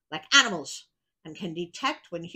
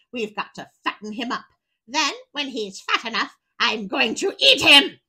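a touch louder witch giving orders; demanding witch; 4 semitones higher, 7 dB louder than usual. Acted. Witch ordering Gretel to fatten Hansel, lightly voiced. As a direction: boss her around like a greedy witch. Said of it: "she yelled".

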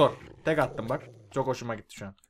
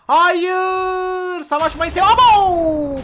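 high, loud cry of dismay; shocked yell; 13 semitones higher, 8 dB louder, much slower than usual. Real, not acted. Gamer yelling as mobs wreck his build, high loud dismay. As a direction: yelp at the ruined build.

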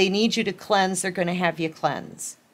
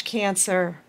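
usual speaking voice

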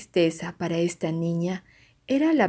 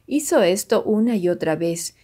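plain reading voice

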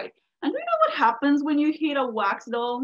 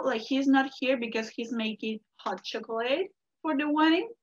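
usual speaking voice

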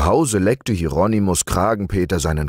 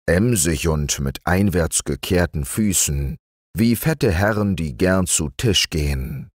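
plain reading voice

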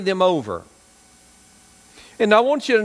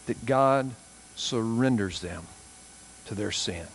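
solemn preaching; 7 semitones lower, 7 dB quieter than usual. Real, not acted. Preacher warning hardened hearts, soft and low. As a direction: speak gravely and gently, heavy pauses.

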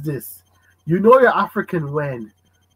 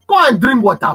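louder, curt brush-off; stern parent dismissal; 5 semitones higher, 5 dB louder than usual. Retold or imitated. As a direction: shut the request down. Parent refusing child.

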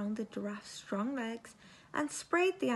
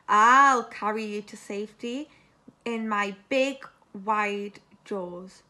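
alligator; 3 semitones lower, 9 dB louder than usual. A narrator's reading. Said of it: "said the alligator".

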